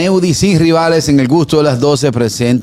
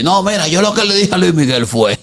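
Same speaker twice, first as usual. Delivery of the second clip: mocking old woman who lost her voice, higher pitched; voice-gone old journalist; 5 semitones higher than usual. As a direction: imitate a worn-out old lady's ruined voice. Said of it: "hablando así".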